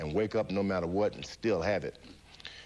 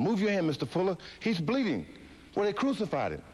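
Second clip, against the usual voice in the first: frantic cry; 11 semitones higher than usual. Retold or imitated. Fighter ordering his masseur in delirium, frantic cry. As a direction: cry out, certain of the vision.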